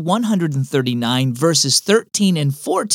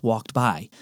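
usual speaking voice

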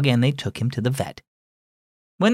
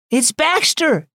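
higher, slow excited cry of a name; exclaiming; 14 semitones higher, slower than usual. A narrator's reading. Seeing the trapped dog, a girl's excited cry. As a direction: call out the dog's name in alarm. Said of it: "Naya exclaimed".

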